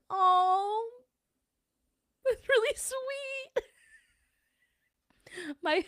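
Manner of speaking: very high, slow and moved; touched. Real, not acted. Touched by a viewer's kind message, high and slow. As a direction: melt at a kind comment. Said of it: "I'm gonna cry now".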